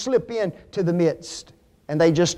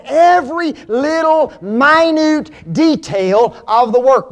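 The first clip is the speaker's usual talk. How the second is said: raised pitch, a beat on each of "every little minute detail"; high, insistent stress on "minute"; emphatic stress; 5 semitones higher than usual. Real, not acted. Preacher hammering the same point.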